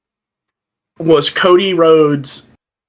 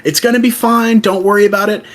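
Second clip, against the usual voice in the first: Cody quote; 7 semitones higher than usual. Retold or imitated. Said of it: "And he's like".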